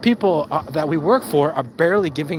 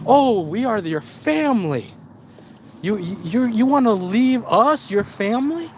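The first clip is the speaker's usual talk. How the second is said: higher, mock-wounded plea; guilt-tripping boss; 6 semitones higher than usual. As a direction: play the hurt employer, laying it on thick. Boss guilt-trips worker who would leave.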